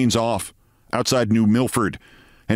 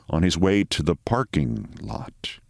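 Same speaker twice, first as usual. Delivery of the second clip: ordinary delivery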